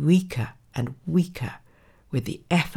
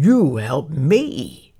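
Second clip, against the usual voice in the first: slow, louder, disbelieving question; incredulous; 5 dB louder, much slower than usual. A narrator's reading. Lion questions the tiny mouse's offer, incredulous. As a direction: draw it out in mocking disbelief. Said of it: "he asked the mouse incredulously".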